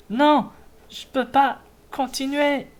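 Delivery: high strained voice giving up; strained high cry. Acted. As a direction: cry out that you can't go on. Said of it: "J'ai dit les cris, je les fais pas".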